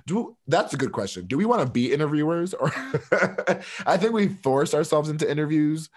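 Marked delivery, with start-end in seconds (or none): 2.8-3.8 laugh. Real, not acted. Laughs at own question.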